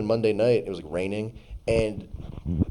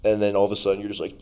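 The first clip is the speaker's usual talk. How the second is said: ordinary delivery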